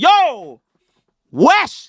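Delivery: high, loud yo wesh in shock; surprised wesh. Shown as a demonstration. Teaching cohost slang, demoing a shocked loud wesh. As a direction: exclaim at a surprise. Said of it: "If something surprising".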